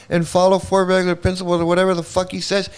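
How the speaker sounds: ordinary talk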